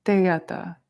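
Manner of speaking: "teata" as tay-ah-ta, no d; Tibetan pronunciation. Shown as a demonstration. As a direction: model "teata" with a tay opening. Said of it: "more of the Tibetan sound".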